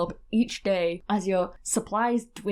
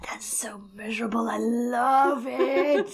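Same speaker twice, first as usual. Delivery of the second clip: hushed, slow delighted whisper; slower than usual. Real, not acted. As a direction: breathe it out in a whisper. Gleeful horror at grim story.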